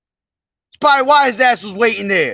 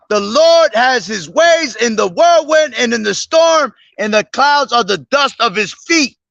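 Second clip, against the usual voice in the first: preaching scripture; 3 semitones higher than usual. Acted. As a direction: proclaim the verses like a street preacher. Reads Nahum aloud preaching.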